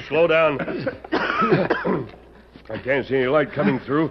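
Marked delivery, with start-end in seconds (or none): 0.6-2.1 dusty cough. Acted. Coughing from dust.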